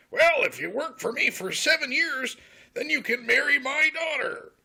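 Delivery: swooping high character voice; exaggerated singsong welcome; hammed-up father figure. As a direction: greet the youngster with big pitch swoops. Father sets terms to Jacob.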